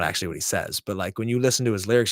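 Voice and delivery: usual speaking voice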